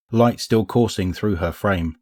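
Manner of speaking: ordinary delivery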